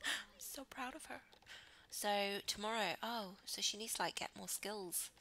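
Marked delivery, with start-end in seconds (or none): 0.0-1.2 a gasp, then soft proud line. Real, not acted. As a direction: gasp and gush.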